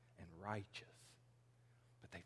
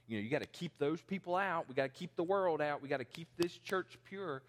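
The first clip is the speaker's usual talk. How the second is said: self-righteous church member; 6 semitones higher, 3 dB louder than usual. Retold or imitated. Mocking exclusionary church members.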